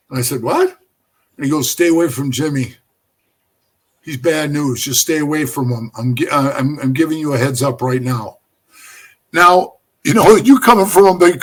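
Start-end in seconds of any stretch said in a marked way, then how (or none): 0.4-0.8 high, rising puzzled question; retold startled query, pitched up; baffled. Prospect answering the boss's odd warning, baffled. 1.7-8.4 low, level retold caution; stern warning. Club boss giving a prospect a heads-up, sternly.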